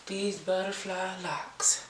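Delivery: soft playful whisper. Pretend. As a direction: breathe it out in a whisper. Whispered tail of chant.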